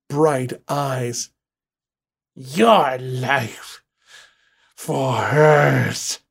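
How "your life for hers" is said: inhuman voice. Acted.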